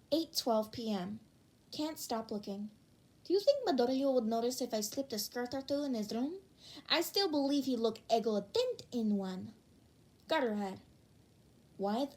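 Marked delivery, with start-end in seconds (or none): none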